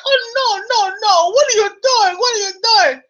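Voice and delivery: mock panic. Husband stops her cutting fish.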